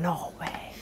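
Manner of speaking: hushed voiceless disbelief; stunned whisper. Retold or imitated. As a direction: whisper it, too shocked to speak. Stunned on meeting the club owner.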